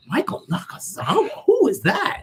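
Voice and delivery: whispered, rising to a high squeak on the question; baffled hush, then a high who is that; mock confused whisper; puzzled whisper. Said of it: "Nick are like".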